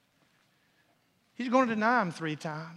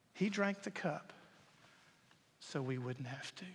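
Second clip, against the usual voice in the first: ordinary talk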